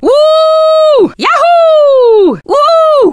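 very high, long whoops; cheering. Acted. Character cheering a victory, high jubilant whoops. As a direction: whoop in celebration, holding the note.

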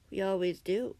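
plain reading voice

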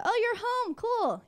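high, loud greeting burst; mock-excited mom. Mom greeting her kid home at night, mock excited.

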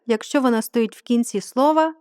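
ordinary talk

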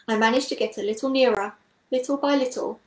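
ordinary delivery

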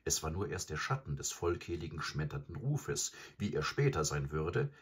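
plain reading voice

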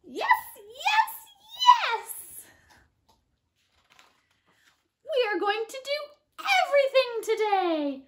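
high, excited piggy voice building. Acted. Piggy telling Gerald their plans, high and excited. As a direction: bounce with excitement like an eager little pig.

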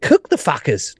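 lightly mimicked coach, high and laughing; acted-out coach. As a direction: retell his glee in a high, laughing voice. Acts out coach wanting players cooked.

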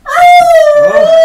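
very high loud startled cry; startled shriek. Real, not acted. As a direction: shriek in surprise.

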